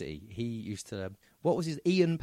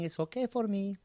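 high-pitched mock Mexican accent; put-on Mexican accent, pitched up; effeminate Mexican accent; 7 semitones higher than usual. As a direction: plead lightly in a camp Mexican voice. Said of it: "your effeminate Mexican accent".

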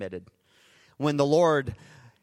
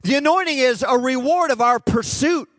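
louder, higher, preaching hard to the crowd; full-force preaching; 5 semitones higher, 5 dB louder than usual. Acted. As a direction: thunder it from the pulpit.

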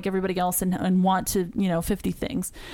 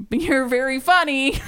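high, slow, drawn-out child imitation; imitated kid's retort; 6 semitones higher, 5 dB louder than usual. Parent imitating daughter's answer to being teased, slow high voice. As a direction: stretch it out, pitched up like a kid. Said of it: "She just said".

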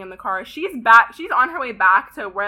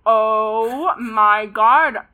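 dragged-out shock; 6 dB louder, much slower than usual. Retold or imitated. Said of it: "And I go".

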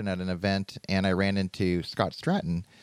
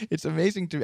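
nervous laughter; 8 semitones higher than usual. Real, not acted. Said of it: "I'm nervous laughing".

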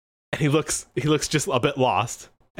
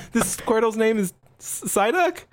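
put-on high, incredulous child's question; puzzled kid trainer; 7 semitones higher than usual. Young trainer asking Guy about the nickname, puzzled. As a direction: ask it high, jumping up on the name. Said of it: "and he says".

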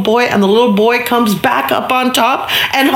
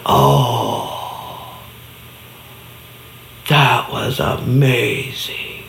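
put-on awed little boy; 8 semitones lower, 5 dB quieter, much slower than usual.

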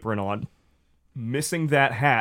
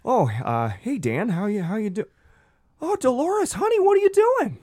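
rising into a very high, loud gasp; Gary's voice; 11 semitones higher, 3 dB louder than usual. Acted. Gary spotting Dolores on the ground, voice soaring high. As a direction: voice climbs as he spots her on the ground.

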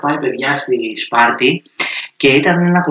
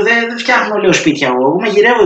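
high, fast and a little louder, protesting he is not the builder; exasperated correction; 10 semitones higher, 5 dB louder than usual. Retold or imitated. Telling a grandma in the supermarket he is a cook, high and fast. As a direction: blurt it out fast, laughing at the mix-up.

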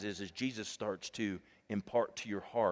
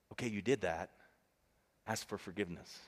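God, gentle; 4 semitones lower, 6 dB quieter than usual. Retold or imitated. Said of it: "And God says".

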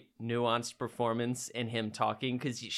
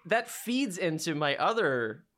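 ordinary talk, nothing marked

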